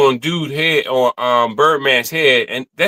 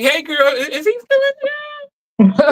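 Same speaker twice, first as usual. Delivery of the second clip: put-on high, slow girly voice; slower than usual. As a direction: squeal it out like a girl gossiping. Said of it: "you be like".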